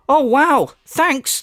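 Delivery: high-pitched mock amazement; sarcastic gratitude. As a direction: exaggerate your thanks for nothing. Sarcastic thanks for wasted time.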